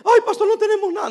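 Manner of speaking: mock a discouraged believer's lament; discouraged member. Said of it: "no se me vayan a agüitar y decir".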